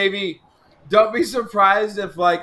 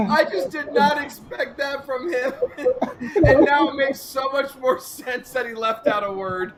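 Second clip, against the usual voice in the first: laughing; 4 semitones higher than usual. Real, not acted. Cracking up at Mark's typo.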